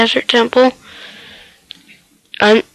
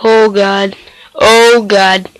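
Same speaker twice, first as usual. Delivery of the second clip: normal talking voice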